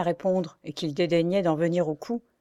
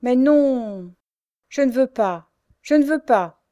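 higher, louder pleading refusal; forceful cry; 6 semitones higher, 7 dB louder, slower than usual. A narrator's reading. Pierre refusing the nickname, crying out with force. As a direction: cry out no with all your force. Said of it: "s'écriait Pierre avec force".

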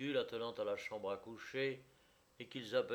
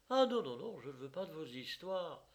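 angry cry; 7 semitones higher than usual. A narrator's reading. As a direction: refuse him sharply, annoyed. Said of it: "cria-t-elle, fâchée".

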